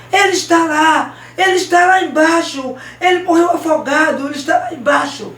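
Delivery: high, loud outcry; anguished shout. Acted. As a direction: cry out the terrible news from the spot. Boy crying out the terrible news. (grito aflito, agudo e alto)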